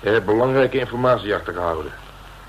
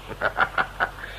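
soft unvoiced chuckle; 7 dB quieter than usual. Gloating over the suspect.

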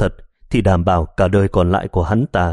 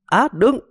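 sudden shriek, far higher; scream of pain. Acted. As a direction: cry out as the kick lands. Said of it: "lại hét lên bảo đừng".

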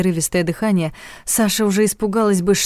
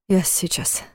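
softer, faint repeat; barely audible; 6 dB quieter, slower than usual. A narrator's reading. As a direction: say it almost without voice. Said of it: "повторил он едва слышно". (едва слышно)

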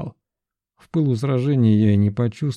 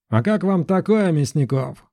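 high ringing challenge; shouted taunt; 7 semitones higher than usual. A narrator's reading. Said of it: "крикнул я".

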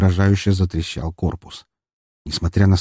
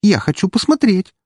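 high-pitched eager request; hopeful, excited plea in a high voice; 11 semitones higher than usual. Acted. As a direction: ask eagerly, voice lifted. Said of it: "с надеждой спросила Фокси".

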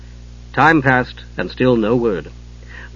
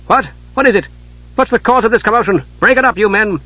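sharp commanding call, much higher; 8 semitones higher than usual. Acted. Sub-commander pushing through his knights, commanding shout. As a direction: bark orders to clear the crowd. Officer breaks up a commotion.

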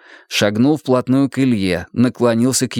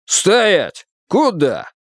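sharp command; 8 semitones higher, much slower than usual. Acted. Officer stops fleeing couple.

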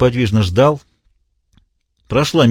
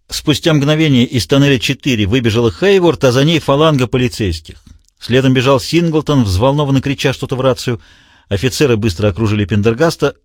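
usual speaking voice

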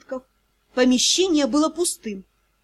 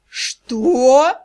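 breathed out; 6 dB louder than usual. Acted. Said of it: "выдохнул демон".

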